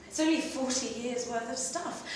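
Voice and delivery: ordinary delivery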